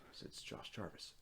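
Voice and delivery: whispered reply, nearly no voice; breathy whispered name; hushed answer. Retold or imitated. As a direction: answer under the breath, nervous.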